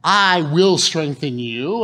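slow, weighty reading of the promise; I will strengthen you, slow and deliberate; slow emphatic scripture. Acted. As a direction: lean on each word of God's promise.

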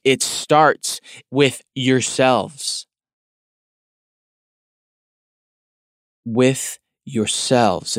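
"yourselves" drawn out slowly and leaned on; slow down and press "With yourselves"; slow stress on yourselves. Shown as a demonstration. Preacher driving home the key word to the congregation, slow and pressed. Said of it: "The emphasis".

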